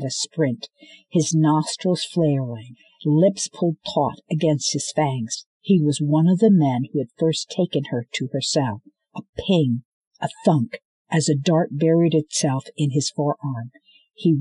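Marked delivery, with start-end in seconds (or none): none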